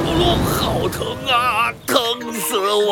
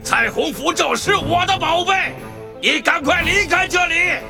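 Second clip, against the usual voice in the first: threatening dragon roar; angry dragon voice; 11 semitones higher than usual. Acted. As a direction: warn him off like a furious dragon.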